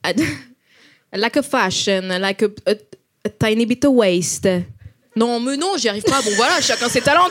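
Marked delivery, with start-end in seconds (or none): 1.1-4.5 halting mock Italian-English accent. Shown as a demonstration. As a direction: put extra vowels on English, Italian-style. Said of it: "une italienne qui parle anglais".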